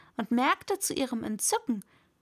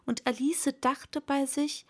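plain reading voice

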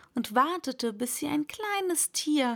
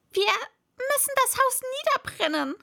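high, raised rabbit voice; calling out; 13 semitones higher, 4 dB louder, faster than usual. Acted. Rabbit urging the others to burn the house, high cry. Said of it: "rief das Kaninchen".

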